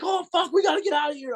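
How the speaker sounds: high, fast, urgent mock panic; panicked friend. Friend bursting into the hotel room in panic. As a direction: squeak it out like a startled buddy. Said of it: "He's like".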